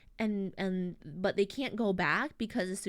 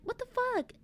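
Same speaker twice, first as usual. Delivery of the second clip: very high, stunned what the fuck; shocked outburst. Retold or imitated. Rebecca hears the dragon's yapping, very high and stunned. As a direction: blurt it in disbelief. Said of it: "Rebecca's like".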